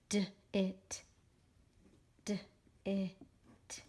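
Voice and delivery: "d-it" broken into its sounds; segmented phonics demonstration; sounding out. Shown as a demonstration. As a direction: say "d-it" as separate d and it sounds. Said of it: "I always write by the syllable".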